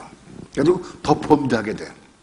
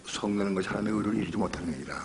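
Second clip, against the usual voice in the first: usual speaking voice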